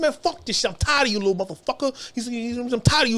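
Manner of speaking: hammed-up furious cellmate. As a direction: raise the pitch, threatening big man.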